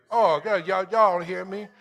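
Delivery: usual speaking voice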